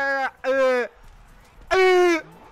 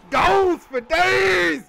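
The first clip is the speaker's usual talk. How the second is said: slow drawn-out cheer; 7 semitones higher, 7 dB louder, much slower than usual. Real, not acted.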